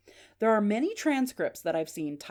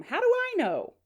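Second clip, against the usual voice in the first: much higher-pitched exasperated mom; mom calling back; 4 dB louder than usual. Acted. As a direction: act out a mother hollering back.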